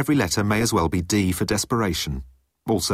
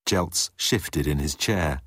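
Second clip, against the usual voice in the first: ordinary delivery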